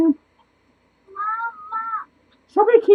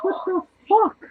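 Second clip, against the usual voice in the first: ordinary delivery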